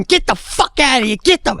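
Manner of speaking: high-pitched yell at attacking dogs; retold yelling. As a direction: re-enact driving the dogs off.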